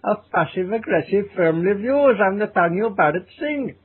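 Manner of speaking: exaggerated comic Indian accent; slow, hammed-up Indian accent; comedy Indian accent. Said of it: "Putting on a comedy Indian accent".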